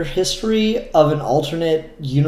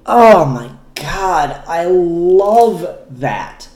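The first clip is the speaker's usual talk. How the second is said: delighted; 4 semitones higher, 4 dB louder, slower than usual. Real, not acted. Delighted reaction to the comic.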